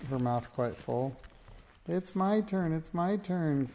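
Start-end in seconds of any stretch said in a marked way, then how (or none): 1.9-3.7 put-on high-pitched, insistent little girl; eager child's cry. A narrator's reading. Alice clamoring to choose next, crying out eagerly. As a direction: pipe up high, claiming a turn. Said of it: "cried Alice".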